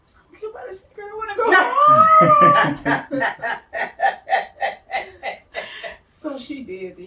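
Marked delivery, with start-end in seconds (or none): 0.1-3.2 desperate wail. Retold or imitated. Begging director to release her, exaggerated wail. 3.3-6.0 loud, high burst of laughing; real laughter. Real, not acted. Laughing at her own retold wail, hearty laughter.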